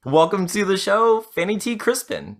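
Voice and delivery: big high-pitched guest introduction; show host welcome. Real, not acted. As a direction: announce the guest with fanfare.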